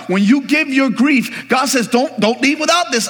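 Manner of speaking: high-pitched, animated pulpit storytelling; excited preacher in full flow; fired-up preaching. Real, not acted.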